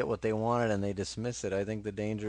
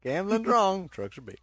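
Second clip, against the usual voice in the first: quoted state motto; 9 semitones higher, 5 dB louder than usual. Retold or imitated. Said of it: "that's a state motto".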